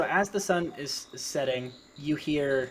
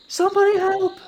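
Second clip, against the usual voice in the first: very high, loud cry for help; acted yell; 10 dB louder than usual. Mother calling out for rescue, high acted yell. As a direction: shriek for help as a frightened woman. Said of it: "you hear her yell".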